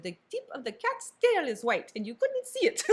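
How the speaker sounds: imitating Poirot in a French accent, rising high; playful French-accent detective voice. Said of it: "in his little French accent".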